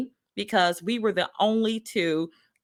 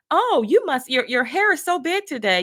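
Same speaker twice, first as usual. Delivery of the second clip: high, exclaiming mock manager; louder, higher imitation of a manager's backhanded remark; imitating manager; 8 semitones higher, 4 dB louder than usual. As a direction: pitch jumps up in mock astonishment.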